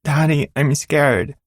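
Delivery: put-on high, slow child voice, frightened; a six-year-old clinging to the handlebars; scared little girl. Little daughter telling her father she's scared, in a high child voice.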